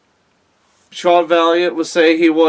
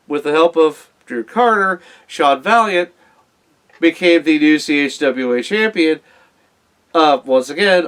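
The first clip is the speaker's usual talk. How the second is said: ordinary talk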